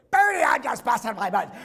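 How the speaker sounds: loud, high, thrilled shout; overjoyed coach shouting. Retold or imitated. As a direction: shout with pride while shaking the boy. Coach after the win.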